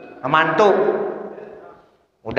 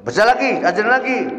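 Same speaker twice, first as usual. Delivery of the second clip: high, exasperated command; annoyed teacher; 8 semitones higher, faster than usual. Acted. Unpaid ustadz telling the child to read, annoyed. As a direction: order it with raised pitch, fed up. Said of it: "ustadznya jadi kesel".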